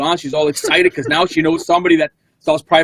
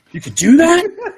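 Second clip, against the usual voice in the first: high, slow astonished disbelief; amazed question; 3 dB louder, slower than usual. Retold or imitated. Lady at dance class gasping in disbelief, high amazed question. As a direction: act out her amazement with a soaring question.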